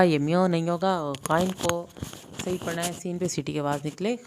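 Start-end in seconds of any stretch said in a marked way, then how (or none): none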